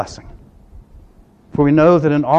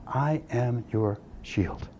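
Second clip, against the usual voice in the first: very slow, hushed and weighty; half-speed solemn repeat, low volume; slow, deliberate; 3 semitones lower, 10 dB quieter, much slower than usual. Retold or imitated. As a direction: let each word land slowly and softly. Repeats the verse for emphasis.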